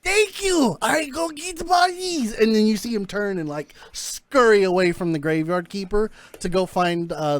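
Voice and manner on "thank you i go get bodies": much higher, slow and eager; Zillock voice. Acted. Zillock thanks Granite before scurrying off, high and eager. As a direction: squeak it out gleefully. Scientist leaves happily.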